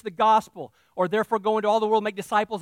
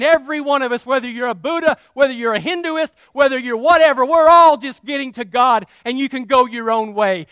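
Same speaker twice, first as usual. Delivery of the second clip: mocking religious leaders; 4 semitones higher, 10 dB louder than usual. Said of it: "coming together saying".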